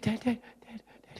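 very soft, high, broken-up calls; imitated child trying to speak through tears; tearful little boy. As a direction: falter on each call. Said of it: "the boy started to cry".

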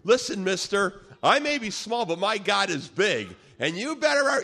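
feisty, high-pitched comeback; tough little guy. Retold or imitated. Small man answering a giant, tough and defiant. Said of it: "this guy was tough".

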